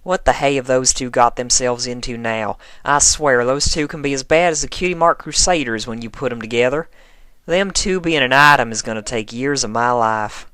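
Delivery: exasperated, half amused. Acted. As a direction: Applejack grumbling about Rainbow and Twilight. Applejack voiced grumbling.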